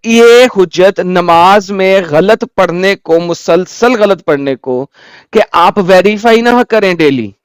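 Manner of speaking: slow, measured, word-by-word questioning; emphatic challenge. Real, not acted. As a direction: press each phrase slowly and deliberately. Challenging the caller's religious argument. (ज़ोर देकर, ठहर-ठहर कर सवाल)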